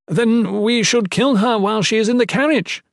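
put-on high, loud, insistent young padre; arguing. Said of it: "Ethan argued".

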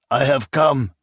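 put-on blunt barbarian with a thick accent; a barbarian chieftain's blunt statement. A narrator's reading. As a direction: say it flatly, no courtesy. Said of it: "the man said bluntly".

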